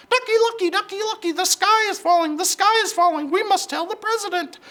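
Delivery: very high, loud, frantic chicken voice; panicked clucking hen. Acted.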